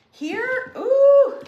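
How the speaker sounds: sudden high squeal of delight; a happy, loud, high ooh at a find. Real, not acted. Host spots a pumpkin in her fall bins, delighted squeal.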